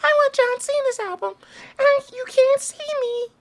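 put-on squeaky falsetto kid voice; childhood self. Gamer as his younger self begging mom, falsetto kid voice. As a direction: plead in a tiny, very high voice. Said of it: "being like".

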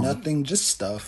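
quiet, slow sulk; sulky mumble. Acted. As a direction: mumble it like a moody kid. Sulky kid brushes off question.

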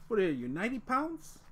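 ordinary talk, nothing marked

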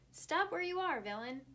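loud, high commanding call; Iron Man commanding. A narrator's reading. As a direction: bark the order from behind. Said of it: "commanded a voice behind him".

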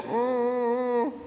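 high sustained hum; middle voice. Shown as a demonstration. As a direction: hum a held note in middle register. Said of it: "And middle".

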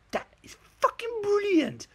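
very high, loud, slow mock delight; over-the-top falsetto gushing; amateur dramatics. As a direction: squeal with hammed-up rapture. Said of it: "it's a bit amateur dramatics".

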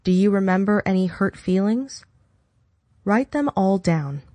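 normal talking voice

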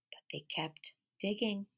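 soft secret whisper of the refrain; whispered echo. Acted. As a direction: hush the repeated line.

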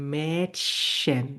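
"Mädchen" slowly, in two parts with a sh-like middle; sh-like CH after umlaut. Shown as a demonstration. Teacher demonstrating umlaut rule, "Mädchen" slowly with sh. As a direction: split "Mädchen" as met-shen, drawn out. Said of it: "আমি এটার উচ্চারণ করব হচ্ছে".

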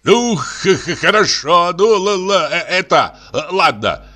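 high, agitated stutter; flustered father stammering in anger; stammering shout. A narrator's reading. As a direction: shout it, tripping over the first sounds. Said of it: "кричал отец".

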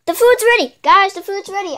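high-pitched, eager call to friends; thrilled news that dinner is here; excited announcement. Acted. Announces food to the others.

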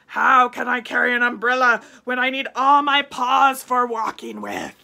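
loud indignant tiger; tiger objecting. A narrator's reading. Tiger scoffing at carrying an umbrella, loud. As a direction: scoff at the silly idea. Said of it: "But the tiger said".

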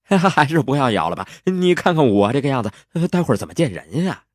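high, quick, laughing protest; comic embarrassed wheedling; sheepish hero. Acted.

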